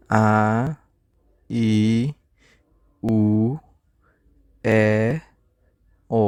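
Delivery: each vowel said slowly on its own with a pause after it; vowel drill. Shown as a demonstration. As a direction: call out the vowels one by one for the class. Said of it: "আবার".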